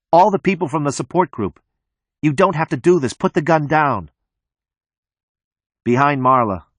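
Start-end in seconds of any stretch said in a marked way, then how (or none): 0.1-4.1 high, pleading acted yell. A narrator's reading. Marla begs him to put the gun down, high urgent yell. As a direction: plead with him in a raised, higher voice. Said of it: "Marla yells".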